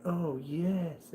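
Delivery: high, drawn-out "screaming" without real volume; mock commercial scream. Streamer imitating shampoo commercial women, mock ecstatic cry. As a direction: imitate an over-the-top shampoo ad moan. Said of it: "they're, like, screaming".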